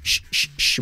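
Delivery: three short voiceless shushes; even, repeated sh bursts; shushing drill. Shown as a demonstration. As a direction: demonstrate three quick shushes. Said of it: "Repite conmigo".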